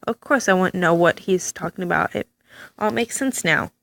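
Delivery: normal talking voice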